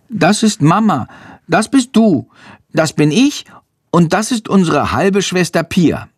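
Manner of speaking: put-on high-pitched small boy's voice; high, eager child voice; little boy. A narrator's reading. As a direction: show off a drawing as a little boy. Little boy explains his picture.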